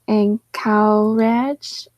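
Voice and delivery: "And cow ranch" slowly, each syllable held apart; three separated syllables, "cow" with an ow vowel; syllable-by-syllable attempt. Shown as a demonstration. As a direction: sound out "And cow ranch" tentatively with a rise at the end. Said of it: "want me to say the last syllable".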